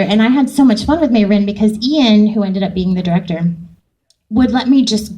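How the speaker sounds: normal talking voice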